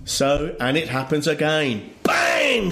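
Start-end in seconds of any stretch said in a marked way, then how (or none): 2.0-2.7 high, loud storytelling burst; exclaimed bang. Retold or imitated. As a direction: land it like a sudden hit. Second cat bite, acted out.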